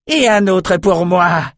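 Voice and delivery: high, drawn-out roar of triumph; slow, exultant high yell. A narrator's reading. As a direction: roar out a boast. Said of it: "rugit-elle".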